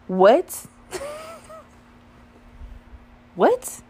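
loud, acted-out shock with a laugh between; shocked disbelief. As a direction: exclaim as if floored by the news. Retold shock at the reveal.

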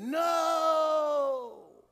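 a lightly voiced child saying no; child's answer. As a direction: answer like a small Sunday school kid. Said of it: "The kid said".

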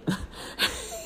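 airy squealing giggle; high unvoiced laughing fit; high breathless laugh. Real, not acted. Laughing at cousin's reaction.

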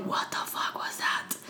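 frightened whisper, no voice; startled hushed whisper; scared whisper. Retold or imitated. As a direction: hiss it under your breath in alarm. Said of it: "I was like".

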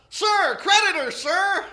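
very loud, high recruit yell. Retold or imitated. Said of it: "he yelled out".

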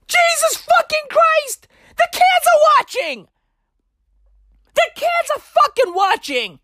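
high-pitched exasperated cry; outraged outburst. Acted. Ranting at gamer girls while kids watch, high outraged voice. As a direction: protest in scandalized, high-pitched disbelief.

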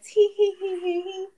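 high, coy mock giggle; playing innocent. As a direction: put on an innocent little tee-hee. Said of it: "playing all innocent".

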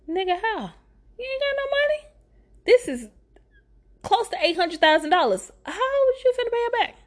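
high-pitched disbelief; incredulous outburst. Acted. Storyteller challenging his promise to repay, high incredulous outburst. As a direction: squeal it in sheer disbelief.

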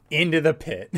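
shouted punchline; loud. Acted. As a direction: blurt it out loud.

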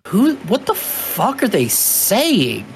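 high, slow baffled Cajun-confused outsider; mock confused soldiers, high and slow. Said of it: "and they're like".

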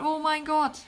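usual speaking voice

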